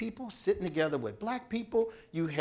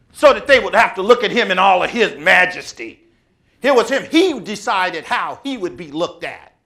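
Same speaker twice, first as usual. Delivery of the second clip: louder and louder preaching climax, slowing on the name; full-force preaching; 3 semitones higher, 10 dB louder than usual. Acted. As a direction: preach it big and proud, land hard on Leon Sullivan.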